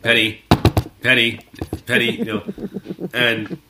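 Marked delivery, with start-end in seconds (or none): 0.0-2.4 imitating Sheldon's knock call, louder; rhythmic calling of Penny. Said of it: "when Big Bang Theory was on".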